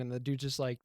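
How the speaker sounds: ordinary talk, nothing marked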